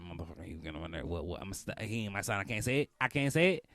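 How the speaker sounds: ordinary delivery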